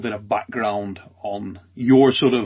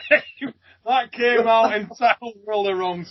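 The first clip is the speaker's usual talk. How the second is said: slow, helpless laughter through the words; can barely get it out for laughing; giggling at the double meaning; 8 semitones higher, slower than usual. Real, not acted.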